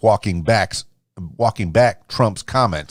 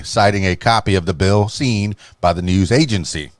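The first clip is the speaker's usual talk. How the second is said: ordinary delivery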